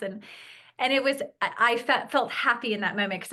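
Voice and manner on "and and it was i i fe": ordinary talk, nothing marked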